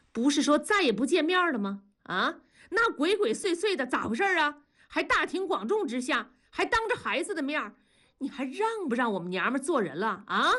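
high-pitched, sharp interrogation; stern questioning. A narrator's reading. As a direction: grill the spouse sternly. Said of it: "厉声地问". (厉声质问)

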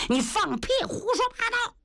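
high, scornful snap; girl's angry retort. Acted. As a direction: cut him off with contempt. Girl furiously rejects him. (姑娘轻蔑地高声怒斥)

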